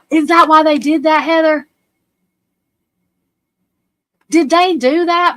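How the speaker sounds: gasp, then a high, loud shocked question. Real, not acted. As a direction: blurt it out in real shock. Shocked by the memorial news.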